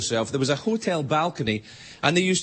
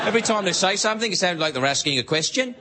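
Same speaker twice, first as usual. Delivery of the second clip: quicker start, then "question" rising at the end; questioning uptalk; faster than usual. Shown as a demonstration. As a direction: lift "something" and "question" as if asking. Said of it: "everything goes up at the end".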